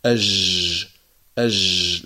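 أج slid out like a French j, air flowing; soft wrong jim. Shown as a demonstration. Teacher mimicking a fricative jim mistake. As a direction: let the ج in أج run on softly. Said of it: "لا نقول أج".

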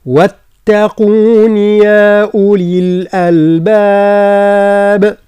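slow, drawn-out tajweed chant; Quran recitation. Shown as a demonstration. Teacher recites the verse for students, slow chant. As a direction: recite it long and steady. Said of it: "تتمة الآية".

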